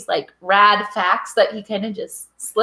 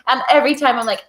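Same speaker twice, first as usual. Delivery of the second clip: usual speaking voice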